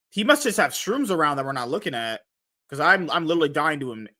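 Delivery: ordinary delivery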